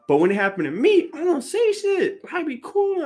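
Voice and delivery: high, squeaky self-defence; indignant protest. Real, not acted. Speaker contrasting himself with shorty, high indignant protest. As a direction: protest it loudly, voice leaping way up.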